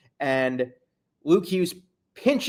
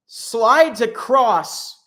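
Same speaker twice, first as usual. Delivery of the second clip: mocking drawl; 5 semitones higher, 5 dB louder, much slower than usual. Real, not acted. Mocking goalie's slow slide.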